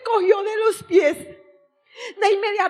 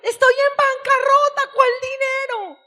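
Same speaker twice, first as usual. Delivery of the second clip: higher, louder protest; indignant shout; 7 semitones higher, 3 dB louder than usual. Retold or imitated. Answering God about money, shouting in protest. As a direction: cry out that she is broke. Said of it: "Yo grité".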